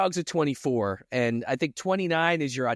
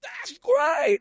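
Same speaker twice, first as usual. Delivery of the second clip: screaming cashier. Retold or imitated. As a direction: yell it like the weird cashier. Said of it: "just scream it".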